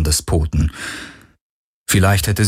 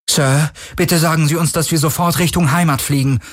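high, pleading request; urgent pleading; 9 semitones higher than usual. A narrator's reading. Terran begs his leader to head home, urgent pleading. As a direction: beg a superior for reassurance.

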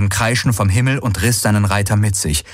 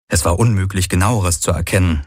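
ordinary delivery, nothing marked

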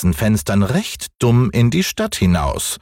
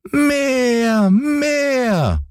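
hohe, quengelnde Kinderstimme; kleiner Junge schreit. Acted. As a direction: fordernd wie ein ungeduldiges Kind. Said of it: "schrie Häwelmann". (hoch und fordernd gerufen)

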